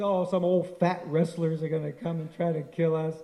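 lightly voiced mocking boys, amused; teasing, lightly laughing dismissal; mocking laughter. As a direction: scoff at the fat wrestlers. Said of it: "And they're laughing".

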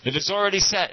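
high, loud pronouncement; loud declaration. Retold or imitated. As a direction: proclaim it like the guru on the dais. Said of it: "and he, loud".

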